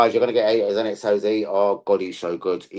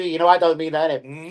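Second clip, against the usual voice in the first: ordinary delivery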